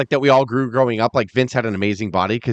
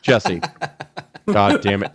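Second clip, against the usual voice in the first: low, exasperated mock reprimand; imitating an irritated boss; annoyed Vince McMahon; 4 semitones lower, slower than usual. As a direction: grumble it like a fed-up boss. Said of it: "he was just, like, annoyed".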